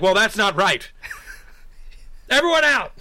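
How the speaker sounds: loud, high cop ordering everyone out. Retold or imitated. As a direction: clear the room like a cop. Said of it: "I pretended to be the cops".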